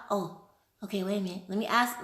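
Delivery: ordinary talk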